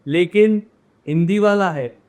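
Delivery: ordinary delivery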